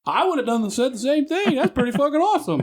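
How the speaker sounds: high, laughing approval; laughing cop. Retold or imitated. Officer siding with the storyteller, high and laughing. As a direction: play the cop cracking up as he agrees. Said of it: "And then he said".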